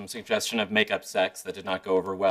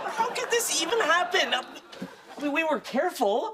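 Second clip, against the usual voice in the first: squealing, very high disbelief; high-pitched comic freak-out; panicked. Acted. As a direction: wail it in falsetto panic. Acted panic at pregnancy news.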